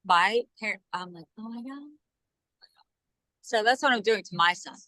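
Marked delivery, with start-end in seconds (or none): 1.4-1.9 hushed, retold dismay; dismayed aside. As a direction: say it very softly, as if to yourself.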